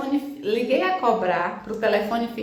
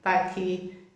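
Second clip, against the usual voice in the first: calming; 6 semitones lower, 8 dB quieter than usual. Retold or imitated. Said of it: "eu serenando".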